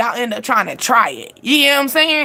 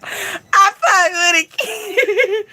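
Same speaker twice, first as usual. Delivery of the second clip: high-pitched, drawn-out laughing approval; 7 semitones higher, much slower than usual. Real, not acted. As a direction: say it breaking up into real laughter. Amused by a comment she read.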